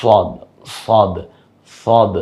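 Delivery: সদ very slowly with rounded lips; rounded-mouth sad. Shown as a demonstration. Teacher showing rounded সদ to students. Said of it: "একটু মুখটা গোল করে".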